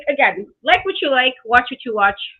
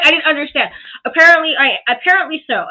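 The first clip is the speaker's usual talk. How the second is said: ordinary talk, nothing marked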